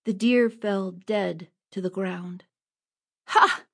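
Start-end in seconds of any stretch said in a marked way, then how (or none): 3.3-3.6 loud victorious Ha; boy's sharp shout of triumph; triumphant cry. Acted. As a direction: crow over the shot. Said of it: "Robin cried".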